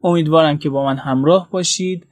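usual speaking voice

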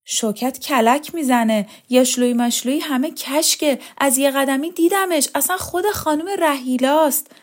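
high, climbing, urgent news; excited report. A narrator's reading. Servant rushing in with the news, excited. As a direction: blurt it out with growing conviction.

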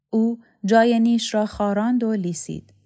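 ordinary delivery, nothing marked

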